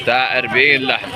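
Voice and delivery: ordinary delivery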